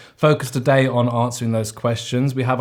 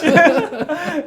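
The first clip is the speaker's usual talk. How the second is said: high burst of laughter; real giggle; 4 dB louder than usual. Real, not acted. Laughing at own joke.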